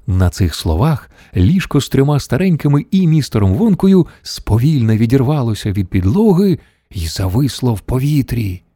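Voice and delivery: usual speaking voice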